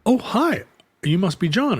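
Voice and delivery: high friendly "Oh, hi"; a cheerful stranger's welcome; a new temp greeting the man she replaced; bright greeting. Retold or imitated.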